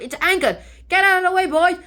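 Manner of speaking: loud, high captain's warning; alarmed shout. Acted. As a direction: shout a hammed-up warning to the crew.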